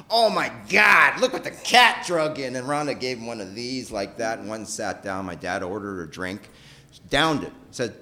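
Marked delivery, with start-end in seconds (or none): 0.1-2.6 dad's teasing jab. Retold or imitated. Dad teasing Rhonda at bar.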